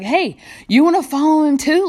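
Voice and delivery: high, loud mocking question; sarcastic jab. Healed man mocking the Pharisees, sarcastic and high. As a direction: needle them with it. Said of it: "I think it's kind of sarcastic".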